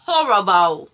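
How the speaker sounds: loud, falling cry of dismay; dramatic disgust. Real, not acted. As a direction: complain it out loud with full drama. Dramatic verdict on bad taste.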